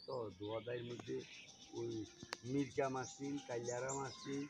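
ordinary talk, nothing marked

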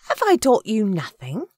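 high, loud motherly reproof; scolding Agatha. A narrator's reading. Agatha scolds him before eating.

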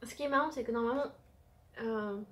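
speaking between bites; chewing while talking. Real, not acted. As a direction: mouth partly full of pastry. Seen 1: holds bitten eclair; jaw and closed lips move as if chewing.

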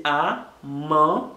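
"a" and "man" separated by a pause; syllable by syllable. Shown as a demonstration. Teacher isolating each sound for learners, syllable by syllable. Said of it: "Premye son ki vin".

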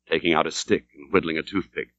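ordinary delivery

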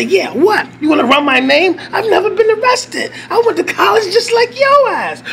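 high, heated challenge to the cops; venting at the police station; defiant yell. Retold or imitated. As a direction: throw it in their faces. Defiant rant at officers.

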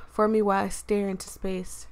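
ordinary delivery

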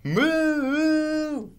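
very high, loud, long moo. Acted. Reader shows a moo heard for miles, loud. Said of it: "so loud it's heard for miles around".

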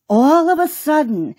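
usual speaking voice

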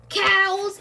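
one loud, high burst; outraged yell. A narrator's reading. Mister Big Nose hearing who is to blame, yelling. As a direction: bellow it in disbelief. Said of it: "yells Mister Big Nose".

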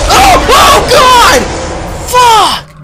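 high, loud startled shriek; real jump-scare yell; terrified scream. Real, not acted. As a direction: scream out in sudden shock. Sudden scare in the game.

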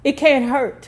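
mother calling down from the staircase; hollering. A narrator's reading. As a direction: holler it back over your shoulder. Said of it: "she hollered back at them".

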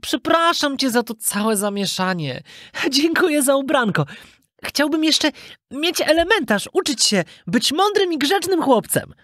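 put-on high, earnest child voice; grateful puppet boy. Puppet boy thanking his father, high and earnest. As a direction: apologise like a little boy, high-pitched.

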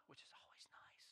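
quick breathy whisper; whispered aside. Real, not acted. Pastor adding a pleased little aside about the salsa, whispered. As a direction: slip it in under your breath.

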